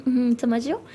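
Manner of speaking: ordinary delivery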